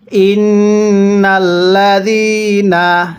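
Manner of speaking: slow, drawn-out tajweed chanting; slow Quran recitation. Shown as a demonstration. Teacher demonstrating recitation to students, slow chant. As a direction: recite each syllable long and measured. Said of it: "পড়ুন".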